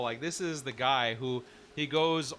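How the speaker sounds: normal talking voice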